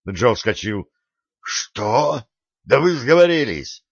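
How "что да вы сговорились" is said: higher and louder, indignant outburst; outraged. A narrator's reading. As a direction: Joe leaps up, furious at them. Joe jumps up, outraged.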